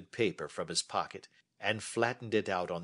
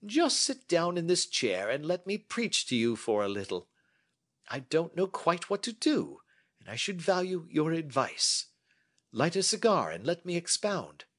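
a touch higher, measured and assured; lightly voiced Holmes; 10 semitones higher, 3 dB louder than usual. Holmes inviting Watson to sit and listen, lightly voiced. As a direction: hold forth like a confident detective.

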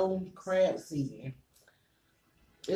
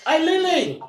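calling out; 12 semitones higher, 12 dB louder than usual. Real, not acted. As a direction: shout across the house for her daughter. Calling her kid in another room.

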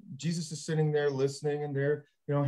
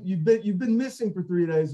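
ordinary talk

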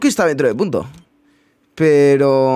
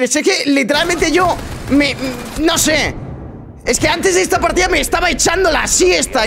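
high-pitched, speechless exasperation; real bewildered, high-voiced protest; exasperated disbelief; 10 semitones higher than usual. Real, not acted. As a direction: complain at the top of your pitch.

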